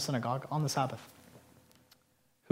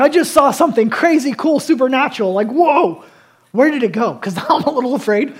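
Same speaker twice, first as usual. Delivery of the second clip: higher, louder, breathless wonder ending in a nervous laugh; amazed onlooker; 6 semitones higher, 10 dB louder than usual. Retold or imitated. Synagogue crowd reacting to the freed man, excited and amazed. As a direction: gush like a stunned bystander. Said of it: "What would you be amazed about".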